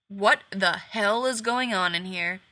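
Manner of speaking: slow, loud, hard-edged demand; angry demand. Acted. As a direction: lay down the law, slowly. Gibbs bursts in, confronting.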